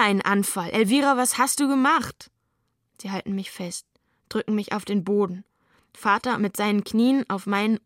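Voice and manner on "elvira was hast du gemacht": sharp, raised demand; father snaps at daughter after the fit; cursing father. Acted. Father confronts Elvira, angry and raised. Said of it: "Vater kommt und flucht".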